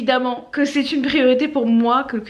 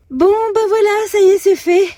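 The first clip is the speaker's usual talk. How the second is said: excited; 10 semitones higher, 8 dB louder than usual. Real, not acted. Done after the workout.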